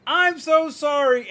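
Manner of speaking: high, loud, slow mock sympathy; overdone condescending sorry, imitating strangers; loud obnoxious pity. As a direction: drag out the fake pity, loud and syrupy. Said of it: "they talk like that. It's loud and obnoxiously done".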